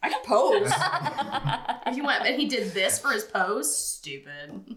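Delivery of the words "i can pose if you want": high, laughing vain poser; high goofy show-off voice. Pretend. Imitating Aang posing.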